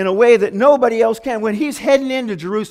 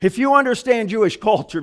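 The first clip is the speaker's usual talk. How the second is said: usual speaking voice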